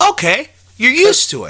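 high-pitched acted relief; mock viewer reaction. Viewer watching a later scene, high mock acceptance. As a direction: pitch it way up, like granting a point.

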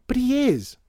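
put-on very high, loud child's retort; defiant outburst. A narrator's reading. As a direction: burst out, insisting at full pitch. Child bursts out in quarrel.

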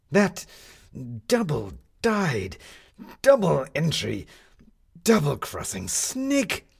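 slow, high, loud furious sneer; indignant outburst. A narrator's reading. Jefferson raging at the sneak Crimble, indignant shout. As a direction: spit out each insult with outrage. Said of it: "heated by his own indignation".

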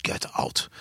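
low unvoiced menace, hissed out; a menacing whisper; whispered threat. To the tent, warden whispers the threat. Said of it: "he grabbed me by my neck and he was just like".

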